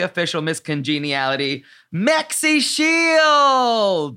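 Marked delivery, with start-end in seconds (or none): 1.9-4.2 big, drawn-out, high announcer call; announcer introduction. Real, not acted. Host introducing the season's contestant, drawn-out announcer shout-out. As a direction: stretch the name out like a ring announcer.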